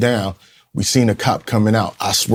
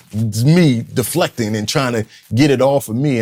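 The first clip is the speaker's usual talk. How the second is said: normal talking voice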